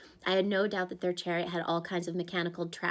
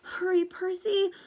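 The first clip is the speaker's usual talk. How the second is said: higher, frightened satyr; whimpering; 10 semitones higher, slower than usual. Acted. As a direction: whimper a hurried goodbye. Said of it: "Grover whimpered".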